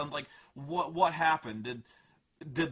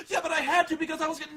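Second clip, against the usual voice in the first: put-on high, fast whiny girl; whiny teen; 11 semitones higher, 5 dB louder, much faster than usual. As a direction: squeal it out in protest. Girl cut off.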